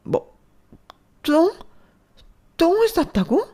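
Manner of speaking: very high, loud disbelieving shriek; mother stunned by the embarrassing confession; incredulous mother. Acted. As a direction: gasp at the word.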